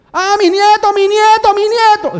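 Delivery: put-on high, loud wailing cry of an old woman; screaming grandmother. Old lady wailing to the guards about her grandson. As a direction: wail it like a panicked grandma. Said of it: "Eso gritaba".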